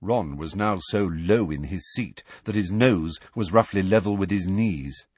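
plain reading voice

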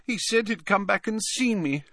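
put-on very high, wounded ghost-girl voice; high-pitched, plaintive accusation; reproachful ghost girl. A narrator's reading. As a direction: reproach him in a high whine. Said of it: "gave Harry a reproachful look".

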